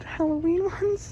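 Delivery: slow, breathless whisper of delight; excited whisper. Real, not acted. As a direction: whisper it like a thrilled secret. Spots Halloween plushies.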